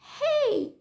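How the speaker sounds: high sharp "Hey!" shot out; mock the mother snapping "Hey!"; mother's sharp yell. Imitating the stranger mother yelling at her, a sharp high shout. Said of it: "Mamanya bilang aku".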